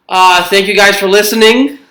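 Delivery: breathless after laughing; winded from laughing, catching breath. Real, not acted. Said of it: "I'm out of breath from all this laughing".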